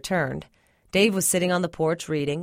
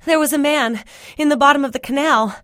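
out of breath; 8 semitones higher, 6 dB louder than usual. Acted. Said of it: "trying to catch my breath".

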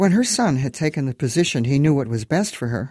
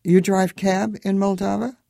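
truncated speech; 7 semitones higher than usual. A narrator's reading. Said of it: "She took on his truncated speech".

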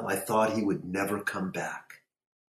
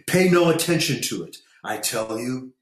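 raised, high, quick outburst; sudden shout; 5 semitones higher, 4 dB louder than usual. A narrator's reading. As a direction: shout it suddenly, easing on the last words.

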